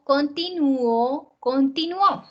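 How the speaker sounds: continuó slow, landing on the final Ó; repeating continuó to show the final stress; stress on last syllable. Shown as a demonstration. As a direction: drag continuó out and push the closing Ó. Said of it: "pronúncialas y presta atención".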